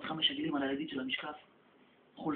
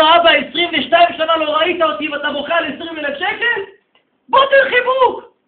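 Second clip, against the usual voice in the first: anguished cry; 10 semitones higher, 18 dB louder than usual. Acted. Son rebukes his crying father, then begs for a hug loudly.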